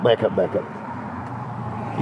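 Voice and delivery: slow, hushed whisper under the breath; whispered back up. Retold or imitated. Friend telling the storyteller to back up, in a slow whisper.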